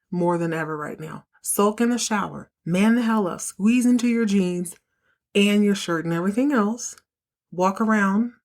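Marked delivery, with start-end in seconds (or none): none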